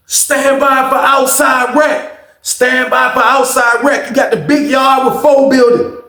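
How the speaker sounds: loud, high, drawn-out bellow; prison announcement. Retold or imitated. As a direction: call it across the whole cell block. Acts out prison announcement call.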